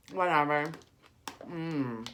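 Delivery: talking while chewing; mouth full. Real, not acted. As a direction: speak through a bite of food. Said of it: "This is really good".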